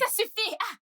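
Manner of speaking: sharp high snap; angry. Acted. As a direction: cut him off in anger. Angry driver cuts him off.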